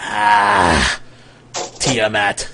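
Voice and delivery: low, labored wizard voice; wounded wizard. Acted. Wounded wizard answers his questioner, low and labored. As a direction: let a weak groan lead into the name.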